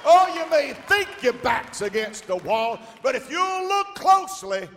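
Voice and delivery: high, loud shouted exhortation; preaching at full force. Acted. Preacher urging the congregation to dig, shouted preaching. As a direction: drive the church to prayer.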